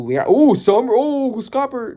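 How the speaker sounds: rising to a high, loud ooh; thrilled fan cheering the hero's entrance; excited. Real, not acted. Fan reacting to Scopper arriving, excited ooh.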